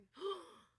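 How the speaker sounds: put-on a big playful gasp of amazement for the kids; mock gasp. Teacher to young students about long ago, theatrical gasp. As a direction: gasp high, like it's shocking news.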